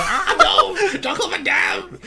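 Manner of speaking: high, drawn-out hammy shout; mock anguished cry. Johnny sees his friend's head ripped off, mock anguished cry. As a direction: wail the name in hammed-up horror.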